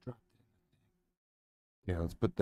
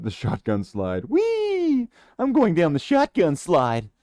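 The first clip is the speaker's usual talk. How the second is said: high, loud delighted whoop; giddy hammed-up glee; playful excitement; 6 semitones higher, 8 dB louder than usual. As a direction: ride the slide like a kid. Playful slide joke.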